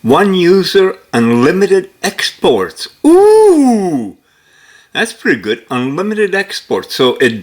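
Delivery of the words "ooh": long, loud, high "ooh" gliding down; impressed "ooh". Real, not acted. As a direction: let out a drawn-out, impressed ooh. Impressed by the export offer.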